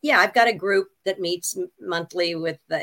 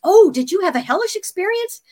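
higher, louder eager voice; mock eager guy; 12 semitones higher, 8 dB louder than usual. Man at meeting eagerly asking about hell, higher. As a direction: gush like a keen group member. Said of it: "And he says".

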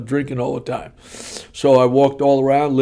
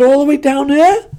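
high, loud mock disbelief; incredulous question; 13 semitones higher, 6 dB louder than usual. Husband balking at walk to altar, high-pitched disbelief. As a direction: squeal the question in comic dismay.

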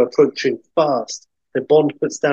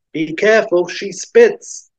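raised country-character voice; 6 semitones higher than usual. Acted. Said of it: "the hick calls back".

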